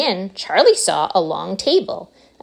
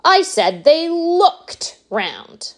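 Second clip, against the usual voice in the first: indignant showman; 10 semitones higher, much slower than usual. Acted. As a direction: brush it off grandly. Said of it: "said Mister Wonka".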